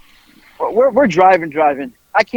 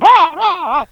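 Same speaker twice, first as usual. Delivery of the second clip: loud, high flustered cries; imitated wail of someone scrambling in panic; frantic mock wails; 11 semitones higher, 3 dB louder, faster than usual. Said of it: "he's scrambling to pick it up".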